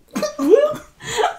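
high laugh. Real, not acted. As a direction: laugh at own joke. Laughing at own pun.

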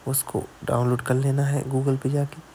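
ordinary delivery

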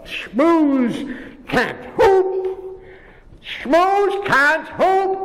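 slow singsong taunt, rising high; a slow sneering schoolyard chant; taunting moose. Acted. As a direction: drag out the mockery.

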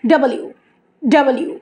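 "ডব্লিউ" opened out as double-you; correct W. Shown as a demonstration. Teacher models correct W, "ডব্লিউ" as double-you. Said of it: "তার মানে দুটো ইউ".

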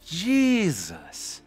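exasperated groan. Real, not acted. Gamer fails wingsuit run.

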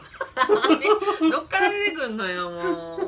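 laughing. Real, not acted. Laughing at partner's odd pose.

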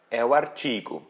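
ordinary talk

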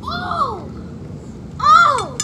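high startled oh; surprised. Real, not acted. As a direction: gasp at what you see. Big reveal.

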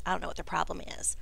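hushed confiding whisper. Acted. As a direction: drop to a whisper. Crackdust confides nervously.

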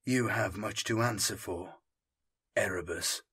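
quiet grim resolve against Erebus; grim thought. Acted. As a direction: promise vengeance under your breath. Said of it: "Santos thought grimly".

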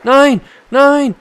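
panisch und laut schreiend; in Panik vor den Wölfen rufen; being chased. Real, not acted. Wölfe greifen im Spiel an. (gejagt, panisch schreiend)

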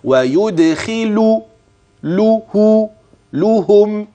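very slow split reading of ويدخل، له، لهم; careful teaching breakdown; syllable-by-syllable demo. Shown as a demonstration. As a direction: break ويدخلهم into its parts slowly. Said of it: "هاء الضمير مرة ثانية على أصلها".

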